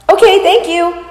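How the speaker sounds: high, curt, annoyed okay; annoyed thanks. Retold or imitated. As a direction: brush her off with a grudging thanks. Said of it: "in an, a little bit of an annoyed tone".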